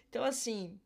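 usual speaking voice

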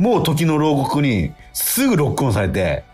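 ordinary talk, nothing marked

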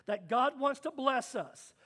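usual speaking voice

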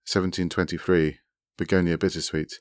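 plain reading voice

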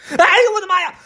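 very high, loud fox shriek; startled storybook fox scream; terrified yelp. A narrator's reading. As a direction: jump out of your skin and squeal. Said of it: "狐狸吓得蹦了起来".

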